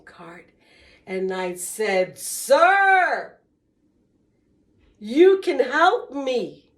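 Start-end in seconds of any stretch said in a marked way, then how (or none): 2.2-6.7 high, loud, slow plea to a stranger; pleading call. Retold or imitated. Woman calling to a homeless man for help, loud slow plea. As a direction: call out to him across the dark street.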